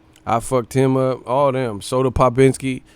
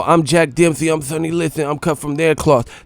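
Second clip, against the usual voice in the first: boastful Tyson brag, higher and louder; Tyson impression; 3 semitones higher, 4 dB louder than usual. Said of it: "Mike Tyson was like".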